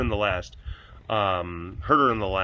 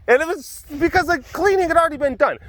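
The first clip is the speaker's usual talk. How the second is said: whiny; 14 semitones higher, 3 dB louder than usual. Retold or imitated. Whiny self-justifying retell.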